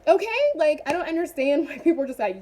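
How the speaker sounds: normal talking voice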